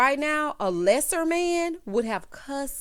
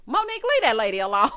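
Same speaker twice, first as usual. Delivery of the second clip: loud, laughing call-out; mock scolding; 6 dB louder than usual. Host telling imagined Monique to back off, loud playful scolding. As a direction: playfully shout her down, breaking into laughter.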